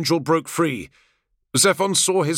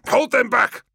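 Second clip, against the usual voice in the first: higher, strained urgent warning from a wounded leader; hissed warning; 8 semitones higher, 3 dB louder than usual. Acted. Primarch warning his brothers off, strained urgent hiss. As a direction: force it out through the strain like a wounded commander. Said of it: "ordered them away in a strained voice".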